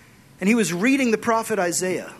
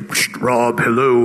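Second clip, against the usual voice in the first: God calling; 9 semitones lower, 5 dB louder, slower than usual. Retold or imitated. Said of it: "God's like".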